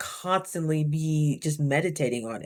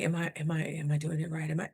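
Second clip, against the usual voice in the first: anxious muttering; 4 semitones lower, 7 dB quieter than usual. Retold or imitated. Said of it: "You're not constantly checking".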